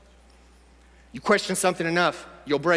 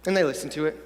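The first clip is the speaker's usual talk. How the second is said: ordinary delivery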